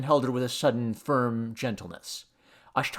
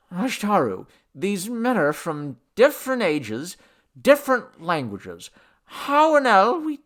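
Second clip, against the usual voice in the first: urgent puzzlement; 7 semitones higher, 4 dB louder than usual. A narrator's reading. Henry puzzles, demanding an answer.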